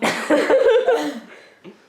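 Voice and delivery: bursting out laughing; big loud laugh. Real, not acted. Blooper laughter.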